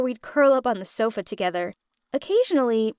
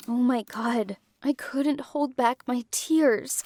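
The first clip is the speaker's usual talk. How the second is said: usual speaking voice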